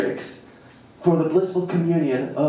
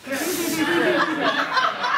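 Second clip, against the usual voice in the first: laugh; 12 semitones higher than usual. Real, not acted. Teacher laughs after "deeply.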